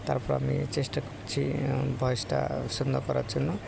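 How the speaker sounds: ordinary talk, nothing marked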